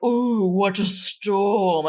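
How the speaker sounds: slow, drawn-out mock theatrical sigh; mock dramatic. Friend mocking movie characters, slow hammed-up gothic drama. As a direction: ham up the brooding gothic crowd. Mocking cape-wearers gazing at storm.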